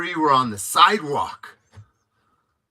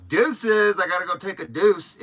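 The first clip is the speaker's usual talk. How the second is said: pitched up, louder mock line; mocking joke; 6 semitones higher, 4 dB louder than usual. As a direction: tease the name like a bathroom joke. Mocking the officer's name Deuce.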